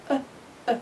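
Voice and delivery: أ said very short and light as a schwa; a quick neutral uh; schwa sound. Shown as a demonstration. Teacher shows students the short schwa sound. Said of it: "هذا صوت شوا".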